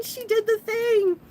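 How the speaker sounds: high, slow, thrilled fan cheer. Retold or imitated. Manga fans in the crowd cheer Venus, high, drawn-out cheer. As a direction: stretch the cheer out. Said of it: "we're screaming and cheering".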